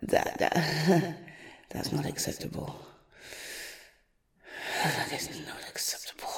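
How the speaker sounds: icy whispered threat; cold menacing whisper. Acted.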